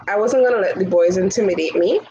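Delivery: usual speaking voice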